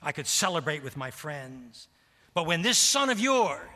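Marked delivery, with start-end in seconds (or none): 2.3-3.7 loud, higher sneer on son; loud, higher and disdainful, son of yours said as an insult; contemptuous curse. Acted. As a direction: spit out son of yours like a cuss word. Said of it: "it's an epithet".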